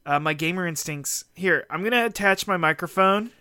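ordinary delivery

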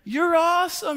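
put-on high-pitched kid praise; cheering little boy. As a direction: imitate a small boy cheering. Dad voices son cheering.

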